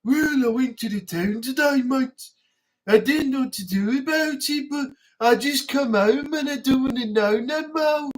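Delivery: dreary Brummie impression, trying to sound keen; mock Birmingham accent. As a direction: put on a bored-to-death Birmingham accent. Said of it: "even when they're trying to be enthusiastic".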